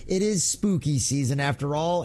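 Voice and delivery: normal talking voice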